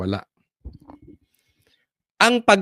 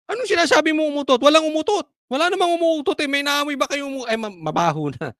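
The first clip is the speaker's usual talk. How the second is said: high, quick mock protest; indignant denial; 11 semitones higher, faster than usual. Someone in the room denying the fart, indignant. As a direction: act out a flustered, high-pitched denier.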